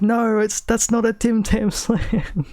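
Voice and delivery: high-pitched mock objection; imitated dismayed protest. Gant corrects Sydney, imitated high protest. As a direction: act out correcting someone in disbelief.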